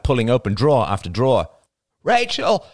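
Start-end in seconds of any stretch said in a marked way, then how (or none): 2.0-2.7 very high, strained call; agonised croak. A narrator's reading. Man in a panic attack calling his girlfriend, agonised. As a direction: cry her name out in agony. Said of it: "croaked Arlo in agony".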